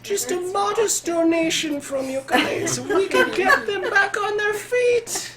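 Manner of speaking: high, whiny merchant voice; squeaky miser griping; high squeaky shopkeeper. Acted. McFlanagan asks for donation.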